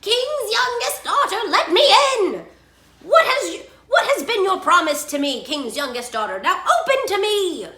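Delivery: high, loud demanding frog; insistent high-pitched shout through a door; crying out. A narrator's reading. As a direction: holler to be let in. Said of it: "and he cried".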